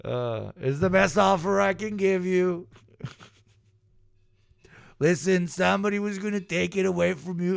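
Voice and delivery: high-pitched put-on dealer's pitch; mock drug dealer. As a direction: plead the offer in a high, silly voice. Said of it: "of a drug dealer".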